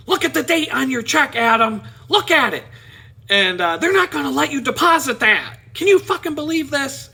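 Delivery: high, loud, angry rant; pissed-off coworker. Retold or imitated. Coworker scolds Adam about his check, high and angry. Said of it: "She's all pissed off".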